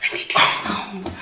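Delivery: slow whispered need; hushed slow demand; urgent whisper. Acted. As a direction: breathe it out, insistent.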